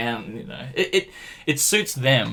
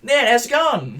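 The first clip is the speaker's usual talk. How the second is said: bright cheery greeting; 10 semitones higher, 5 dB louder, slower than usual. Retold or imitated. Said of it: "I'm like".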